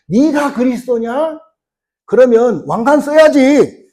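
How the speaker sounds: high, loud, drawn-out jeer; mocking. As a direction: act out a crowd taunting, slowly and high. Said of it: "막 조롱하잖아요".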